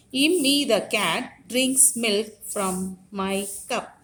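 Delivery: put-on slow, sing-song rhyme read to children; teacher reciting Immi the cat from the book; nursery rhyme recitation. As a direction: chant the rhyme slowly for little kids. Reads nursery rhyme aloud.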